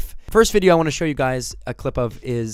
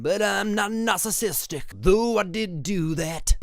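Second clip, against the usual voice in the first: silly host voice; 6 semitones higher than usual. Pretend. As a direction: ham up a fake talk show host. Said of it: "I'm gonna talk like this the entire time".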